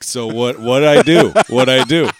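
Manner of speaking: confronting. Retold or imitated. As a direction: demand an answer with comic alarm. Acted confronting one lady.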